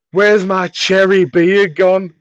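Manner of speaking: high, loud, slow whine; whiny teen. Retold or imitated. Teen Chris wailing to friends about his beer. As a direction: wail it like a wronged teenager.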